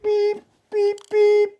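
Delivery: high squeaky beep beep beep; imitated alarm beeps. Vlogger mimicking phone alarm waking him, high squeaky beeps. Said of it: "they start going beep".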